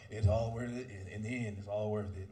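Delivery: weary married guy. Retold or imitated. Long-married man telling young guys it's worth it, resigned and low. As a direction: sigh it out like a worn-down husband. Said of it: "that's how he talk".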